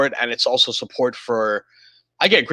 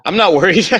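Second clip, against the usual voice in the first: high giggly denial, louder; laughing denial; 6 semitones higher, 6 dB louder than usual. Pretend. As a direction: insist while laughing. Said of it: "joking about crypto market".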